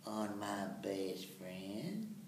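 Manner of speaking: slow, soft, stretched Mater delivery; drawn-out Mater line. Shown as a demonstration. Actor recalls his first Mater take, slow and soft. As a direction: let friend rise slowly at the end. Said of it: "the first time I did that".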